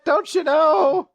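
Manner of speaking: high-pitched hype yell; over-the-top squeaky bragging; excited fanboy. Retold or imitated. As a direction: brag for your hero in a high squeal. Voicing excited hype-man character.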